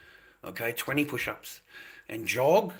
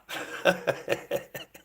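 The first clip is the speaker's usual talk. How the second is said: breathy laugh; 6 semitones higher than usual. Real, not acted. Laughs after own quote.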